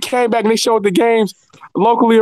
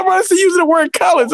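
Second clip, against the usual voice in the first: high-pitched, stunned retelling; shocked disbelief; 11 semitones higher than usual. Retold or imitated. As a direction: say it like you can't believe it. Retelling shocked reply to coworker.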